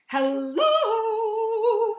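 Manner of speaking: cheery mother. Acted. Mother greets grumpy son.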